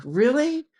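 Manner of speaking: sharp, very high disbelieving really; high incredulous squeak; surprised really. Retold or imitated. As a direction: sound stunned. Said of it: "And I'm like".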